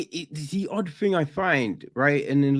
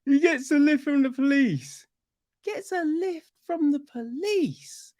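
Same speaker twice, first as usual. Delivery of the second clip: very high, squeaky disbelief; high-pitched astonishment at the absurdity; incredulous; 13 semitones higher than usual. Real, not acted. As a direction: repeat it way up high, baffled. Repeats the baffling plot point.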